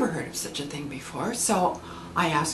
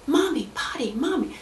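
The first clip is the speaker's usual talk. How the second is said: put-on high little boy pleading for mommy; child calling; 7 semitones higher than usual. Toddler begging mom during the hymn, high pleading. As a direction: plead up high like a three-year-old.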